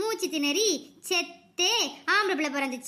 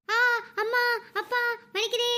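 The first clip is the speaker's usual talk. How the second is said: higher-pitched cry of pain; labour pains; 4 semitones higher than usual. A narrator's reading. Raasaathi hit by labour pains at midnight, crying out in pain. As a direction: moan out through a contraction. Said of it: "வழியில கத்திக்கிட்டு இருந்தா ராசாத்தி".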